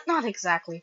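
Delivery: ordinary delivery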